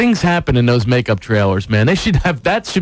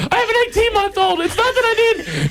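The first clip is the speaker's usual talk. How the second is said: very high, wailing mock plea; frantic wife. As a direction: imitate a panicked pregnant wife pleading. Wife pleading with fake policeman.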